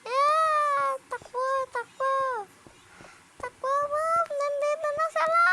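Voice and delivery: loud, high excited running shouts; while running. Pretend. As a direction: yell as you flee in a panic. Fleeing the teacher in game.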